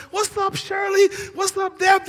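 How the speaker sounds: put-on high, loud happy shout to an old buddy; excited greeting shout. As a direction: yell a joyful what's up. Greeting the girls loudly.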